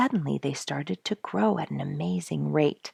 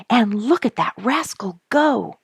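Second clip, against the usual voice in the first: higher, louder delighted cry; excited cry; 7 semitones higher, 7 dB louder than usual. Acted. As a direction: shout it out in delight as the insect flies off. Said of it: "cried Duke".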